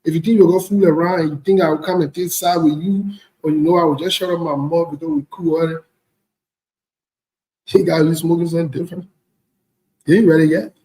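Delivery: normal talking voice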